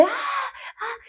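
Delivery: high, thin mock scream dying away; soft, high re-enacted cry; imitated scream. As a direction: scream in miniature. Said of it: "así como que grito y".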